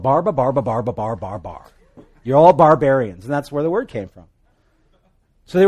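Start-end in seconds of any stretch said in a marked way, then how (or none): 0.0-1.7 mock barbarian babble. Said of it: "the way the Greeks saw everybody".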